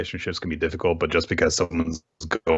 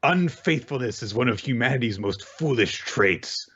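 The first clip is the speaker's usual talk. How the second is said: mock outraged Power; 7 semitones higher than usual. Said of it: "she's like".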